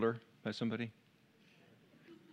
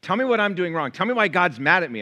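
higher, louder mock complaint; frustrated churchgoer; 6 semitones higher, 5 dB louder than usual. Church member demanding to know why God is angry, higher and louder. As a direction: press for an answer. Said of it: "I've heard people say to me".

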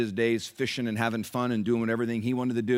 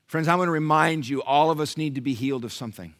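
ordinary talk, nothing marked

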